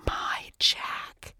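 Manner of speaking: soft, voiceless whisper of shock. A narrator's reading. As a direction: whisper it softly, all breath. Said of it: "she whispered".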